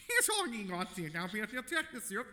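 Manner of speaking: high, loud garbled gibberish; alien dog voice. Retold or imitated. Dog on the street speaking alien, loud high gibberish. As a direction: speak strange nonsense as a talking dog. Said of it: "the dog ... looked at you and just went".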